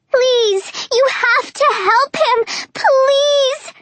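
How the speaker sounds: high, tearful pleading; desperate begging. Acted. Begging soldiers to help.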